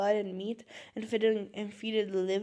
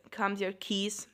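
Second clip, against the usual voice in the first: ordinary talk, nothing marked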